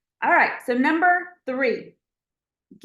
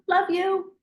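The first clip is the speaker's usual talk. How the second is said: much higher, breezy pet voice; 9 semitones higher than usual. Pretend. A scolded pet bouncing right back, high and breezy. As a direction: play the pet, carefree and forgiving. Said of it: "are they just right back and like".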